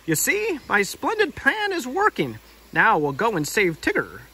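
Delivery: pompous Rabbit voice; smug Rabbit voice. Acted. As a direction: gloat over the clever plan.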